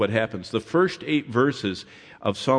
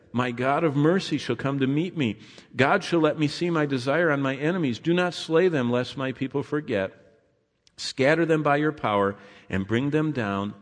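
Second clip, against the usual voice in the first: raised, ringing psalm read; scripture reading; 3 semitones higher than usual. Acted. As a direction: proclaim it with fervour. Preacher reads psalm aloud.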